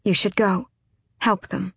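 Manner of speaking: thin, slightly raspy urging; thin rasp. A narrator's reading. Aylin urges him to help his companions, thin rasp. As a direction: push it out thinly, with a rasp. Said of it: "her voice a thin rasp".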